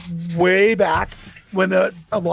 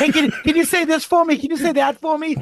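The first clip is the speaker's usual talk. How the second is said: higher-pitched mock of an eager requester; pestering stranger; 9 semitones higher than usual. Strangers asking a Bostonian to perform, mock pestering. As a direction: imitate someone begging for a party trick.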